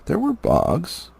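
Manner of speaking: ordinary delivery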